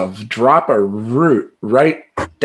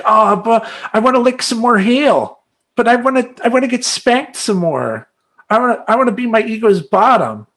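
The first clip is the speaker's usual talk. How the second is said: whiny self-mockery; 10 semitones higher than usual. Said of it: "is it gonna be like".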